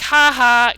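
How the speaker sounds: loud mocking "ha ha" like an evil smirk; evil smirk laugh. Imitating a stalker's evil smirk photo, loud. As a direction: sneer out a gloating laugh. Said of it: "kinda like that".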